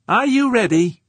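very high, loud shout. A narrator's reading. George to crewman beside the laser, shouting. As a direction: bellow the question across. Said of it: "he shouted at Nodon".

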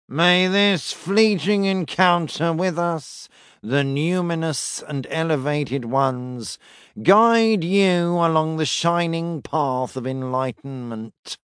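slow, even, droning priest; flat monotone voice. Acted. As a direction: intone it without any feeling. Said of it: "intoned in a flat, vaguely nasal voice".

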